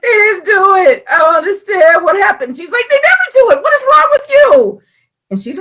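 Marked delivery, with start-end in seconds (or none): 0.0-2.6 high, loud tearful complaint, put on; mock wailing. 2.9-4.8 very high, loud imitated scolding; shrieky fed-up sibling impression; exasperated sister.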